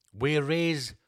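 ordinary delivery

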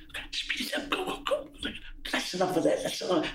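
quick hushed urging; whispered cues. Retold or imitated. Director whispering cues during a take, hushed and urgent. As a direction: whisper to hurry him along.

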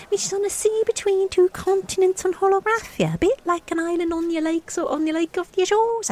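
very high, earnest character voice; youngest elder, explaining. Acted. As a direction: reel off the quest in a high put-on voice. Youngest elder lists the long quest.

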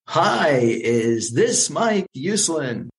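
lightly voiced exuberant caller, a high bright "Hi" then a very slow question; a hint of a booming showman on the phone; exuberant male voice. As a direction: greet him like an overjoyed fan. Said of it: "this exuberant male voice".

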